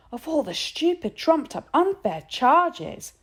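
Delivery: high, loud outraged outburst, slowing on the last words; exploding in anger. A narrator's reading. Boy storming outside the office, furious outburst. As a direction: spit out the injustice. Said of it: "he exploded".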